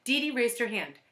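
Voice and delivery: ordinary delivery, nothing marked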